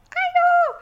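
very high, loud squeal of disbelief; amazed exclamation. Real, not acted. Host amazed that another dog shares her dog's name. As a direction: shriek it in delighted shock.

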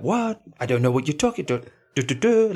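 high, hammed-up French accent, feigning confusion; exaggerated fake French innocence; mock French protest. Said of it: "holding her arms in the air, like".

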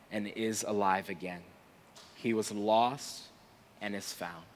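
gentle and reassuring, letting it fade; tender father. Acted. Father answers his angry older son, tender and hushed.

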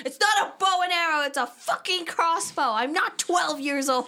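high, loud, enraged yell about the crossbow; outraged shriek at a stranger's innocent question; retold furious scream. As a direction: scream it like someone who just snapped. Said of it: "just stops and screams".

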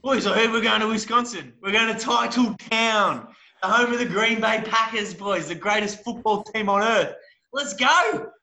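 high, excited rallying call; hyped-up superfan. Acted. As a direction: pump up the boys like a die-hard fan. Superfan hypes up the trip.

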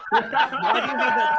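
laughing through the words; can't stop laughing while asking. Real, not acted. Reacts laughing to the roar.

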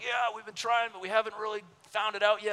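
high, put-on enthusiastic voice; imitating an overexcited stranger; eager tourist angler. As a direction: play a gushing, clueless vacationer. Same angler replies.

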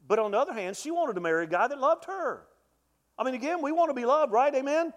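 climbing, urgent sermon voice; preaching at full force. Acted. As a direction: push the plea hard like a revival preacher. Preaching peak, pleading.

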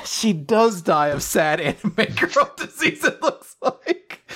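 giggling through the words, ending high; laughing. Real, not acted. Podcaster reacting to the dumb twist, laughing. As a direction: laugh it out in disbelief.

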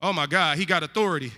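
ordinary talk

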